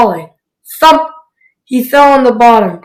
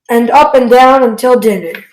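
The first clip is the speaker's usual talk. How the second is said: usual speaking voice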